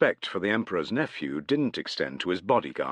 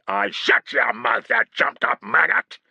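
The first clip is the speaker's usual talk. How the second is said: furious insult; angry rebuke; 6 semitones higher, 7 dB louder than usual. A narrator's reading. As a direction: tear into him. Angry exchange with guard.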